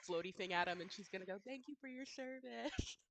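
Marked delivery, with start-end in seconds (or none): 1.4-3.0 war wife farewell. Acted. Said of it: "the little handkerchief floaty thing".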